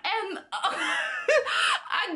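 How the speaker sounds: high-pitched, breaking into real laughter; can't get the words out for laughing; laughing hard. Real, not acted. Telling viewers how she fled grandma, laughing hard. Cracking up retelling her exit.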